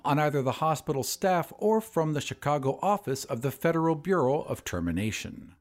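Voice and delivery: usual speaking voice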